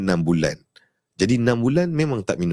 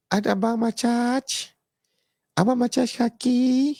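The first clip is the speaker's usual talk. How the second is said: much higher, slow, put-on childish voice; mock squeaky kid; 13 semitones higher, slower than usual. Mimicking a kid who says Abang Macaj for tapak kaki, high and slow. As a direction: stretch it out like a little kid mishearing. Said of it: "Nanti dia, dia je".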